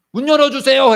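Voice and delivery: high, loud demand at the door; robber at the door. Pretend. Robber demanding to be let in, high and loud. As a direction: call through the door like the thief. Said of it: "마치 강도가".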